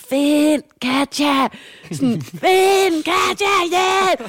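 high, slow, drawn-out cries for help; panicked shouting. Retold or imitated. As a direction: yell for her as if terrified in the dark. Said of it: "begynder at råbe sådan". (højt, langtrukkent panisk råb)

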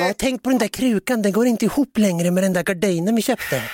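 high put-on voice; nagging partner. Partner discussing pot and curtain, high mock fussing.

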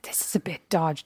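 soft slow whisper of doubt; suspicious whisper. Retold or imitated. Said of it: "I was like".

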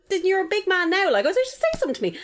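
high-pitched retold goading; mocking challenge. Retold taunt to teenager.

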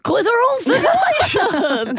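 loud, high, laughing host announcement; game show host voice. Shown as a demonstration. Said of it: "a silly game show host voice".

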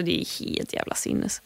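hushed. Real, not acted. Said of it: "tre gånger så".

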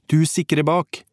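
high-pitched, urgent command; shouted order. A narrator's reading. Narrator orders Kåre to cover the rear, urgent shout. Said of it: "ropte jeg".

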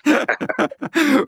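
a quick amused chuckle; short laugh. Real, not acted. Radio historian laughing at short-lived station. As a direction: laugh it off briefly. Laugh after three days.

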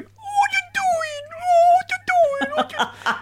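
very high, drawn-out cooing squeal; mock dog voice. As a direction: imitate owners squealing baby talk at their dogs. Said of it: "grown people standing at the front of the stage going".

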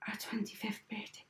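breathless whispered aside. Retold or imitated. Mother realising the surgery date, whispered. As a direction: whisper it in dismay. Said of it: "And my ma just goes".

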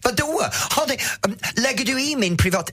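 high, indignant mock reply; imitating offended girl. Girl's offended retort imitated. (härmad förnärmad tjej, ljus röst)

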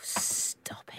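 hushed amazed whisper; whispered disbelief. Real, not acted. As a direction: breathe it in awe. Whispered astonishment.

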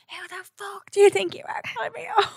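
high, half-laughing retold yell; retold scream. Retelling yelling back at the man who insulted her, mock yell. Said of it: "I was screaming".

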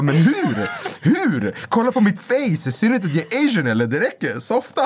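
high, incredulous comic outrage; retold angry retort. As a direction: act out firing indignant questions at a partner.

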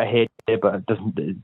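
ordinary talk, nothing marked

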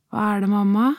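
lightly voiced small boy, concerned; child's voice. A narrator's reading. Son asking upset mother, lightly voiced child. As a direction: gently ask what's wrong.

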